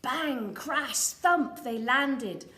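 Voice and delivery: loud, punchy sound words; bang crash thump. A narrator's reading. Reading the crash landing to a child, loudly. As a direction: boom out each landing word.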